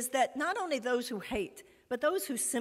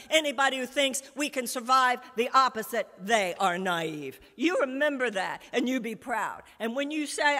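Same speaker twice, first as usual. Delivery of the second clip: ordinary delivery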